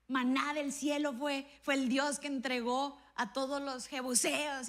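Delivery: rising fervent preaching; slow, swelling preacher cadence; preaching build. Acted. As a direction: build the list with mounting fervor.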